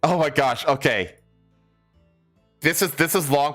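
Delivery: ordinary delivery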